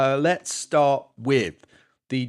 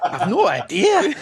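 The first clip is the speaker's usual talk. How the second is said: high, laughing bad Scottish; botched Scottish accent attempt; 7 semitones higher, 4 dB louder, slower than usual. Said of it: "See, that was terrible".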